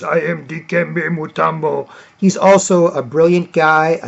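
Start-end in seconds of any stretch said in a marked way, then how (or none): 0.0-1.9 imitated rumbly African-accented voice; Dikembe Mutombo. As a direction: put on a big rumbly voice, evenly pitched. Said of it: "very, very deep, rumbly voice".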